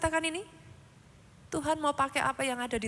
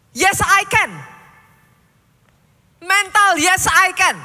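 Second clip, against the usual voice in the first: loud, higher declaration shouted out; rallying shout; 4 semitones higher, 14 dB louder, slower than usual. Acted. Preacher rallying the congregation in a loud shout. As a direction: shout it like a battle cry.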